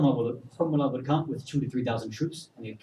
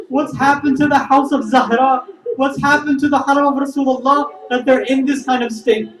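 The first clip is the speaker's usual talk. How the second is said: loud, high, tearful outcry; anguished lament; 11 semitones higher, 10 dB louder than usual. Acted. Companion cries to God on receiving the princess's greeting, anguished lament. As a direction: wail it out at full preaching force.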